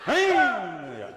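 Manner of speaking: short loud call. Shown as a demonstration. Coach calling out to show the accent, loud. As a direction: call out to grab attention. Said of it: "coach calls out for emphasis".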